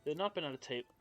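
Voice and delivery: usual speaking voice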